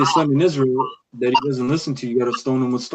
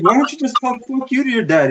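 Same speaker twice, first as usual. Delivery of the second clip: mock Edomite kid; 10 semitones higher than usual. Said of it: "The Edomite's like".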